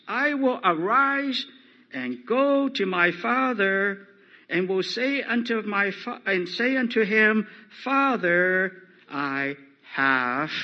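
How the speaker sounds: loud, high, slow verse reading; declaimed scripture. Acted. Preacher reading the prodigal's words to his father, declaimed scripture. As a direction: proclaim the verse from the pulpit. Reads the verse at full force.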